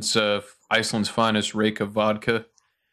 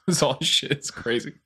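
ordinary talk, nothing marked

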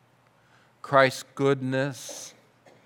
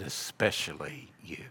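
soft low whisper, much quieter; tender whisper; 8 semitones lower, 11 dB quieter than usual. Retold or imitated. Pastor retelling comfort to a tearful waitress, whispered. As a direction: whisper it close, like a gentle secret.

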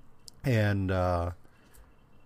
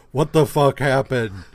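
louder, incredulous retold outburst; loud mock outburst; 3 semitones higher, 9 dB louder than usual. Podcaster voicing the Hulk waking up confused, loud mock outburst. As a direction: blurt it out loud, playing the baffled Hulk. Hulk's outraged reaction retold.

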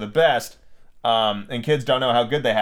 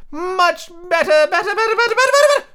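very high squeal, louder and faster; rising squeak; 7 dB louder than usual. A narrator's reading. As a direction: climb into a frantic bleating squeak. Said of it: "her voice rising into a squeak as she went on".

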